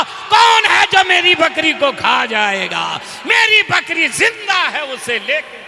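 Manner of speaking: high, loud preacher's shout; rousing sermon climax; fiery preaching. Acted. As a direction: drive the point home at full volume. Preaching at full force.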